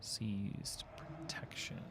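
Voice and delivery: slow, soft whispered read. Real, not acted. Streamer reading a game label to himself, slow whisper. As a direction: read it slowly under your breath. Reading an item under breath.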